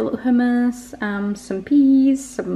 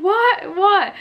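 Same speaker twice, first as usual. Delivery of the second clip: alarmed reply; 12 semitones higher, 4 dB louder than usual. Retold or imitated. Partner reacts alarmed.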